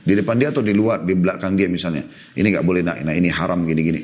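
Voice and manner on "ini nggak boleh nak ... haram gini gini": gentle correction. Shown as a demonstration. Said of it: "tegur dengan cara yang baik". (teguran lembut dan pelan kepada anak)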